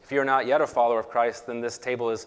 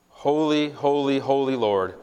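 proclaiming; 4 semitones higher, 6 dB louder than usual. Real, not acted. Liturgy response.

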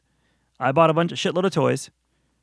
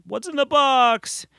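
hammed-up movie quote; 11 semitones higher, much slower than usual. Famous movie line quoted.

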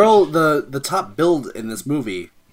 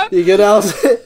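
laughing; 13 semitones higher, 6 dB louder than usual. Real, not acted. Asks laughing friend.

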